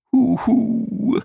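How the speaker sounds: high whimpering hoo-hoo; a little creature's whimper; whimpering huhu. A narrator's reading. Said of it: "wimmerte der Nachtalb".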